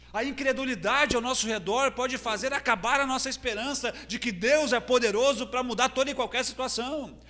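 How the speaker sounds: high, loud, driving sermon voice; full-force preaching. Acted. Preacher warning that unbelief around us kills hope, fervent. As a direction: preach it with mounting fervour.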